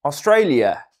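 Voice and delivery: loud, proud Aussie Australia; Australian accent. Pretend. Streamer retries Aussie accent and nails it, loud. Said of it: "That's it".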